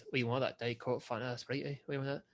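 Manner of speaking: put-on soft, run-together Scottish accent; thick Scottish accent. Imitating the service station man listing drinks, thick Scottish accent. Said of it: "what he actually proceeds to say".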